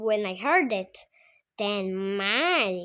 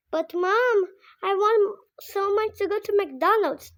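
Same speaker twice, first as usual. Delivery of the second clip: put-on high pleading child voice; whiny begging kid; 11 semitones higher, 6 dB louder than usual. Kid pleading with his mom for McDonald's, high whine. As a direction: beg Mom, much higher and louder.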